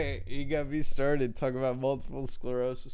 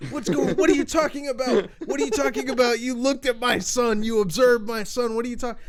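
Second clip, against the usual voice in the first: higher-pitched frantic questioning; dad freaking out; 7 semitones higher than usual. Retold or imitated. Dad to prank caller about his son, freaking out. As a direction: imitate a dad losing it, repeating himself. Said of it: "he's, like, all freaking out".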